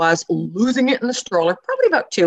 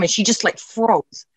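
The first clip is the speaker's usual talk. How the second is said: ordinary talk, nothing marked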